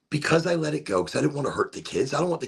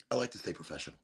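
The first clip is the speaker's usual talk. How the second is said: low, soft deadpan repeat; deadpan the line as a joke; mock serious; 9 semitones lower, 13 dB quieter, much faster than usual. Repeating the line to viewers, mock serious deadpan.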